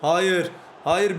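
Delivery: normal talking voice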